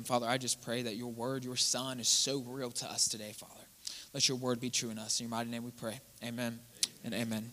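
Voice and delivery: hushed prayer, almost whispered. Real, not acted. Pastor praying after the reading, hushed and soft. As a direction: pray it softly, low.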